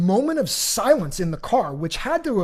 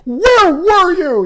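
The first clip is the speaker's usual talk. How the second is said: slow, much higher and louder scold; scolding parent; 11 semitones higher, 12 dB louder, much slower than usual. Retold or imitated. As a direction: yell like a worried parent at a lost kid. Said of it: "who's, like, the other parent, is like".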